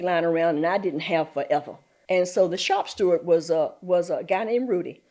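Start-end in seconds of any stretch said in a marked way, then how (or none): none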